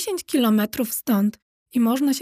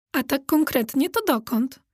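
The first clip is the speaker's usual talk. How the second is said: plain reading voice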